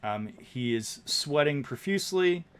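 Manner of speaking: ordinary talk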